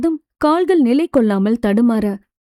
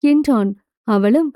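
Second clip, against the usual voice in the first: usual speaking voice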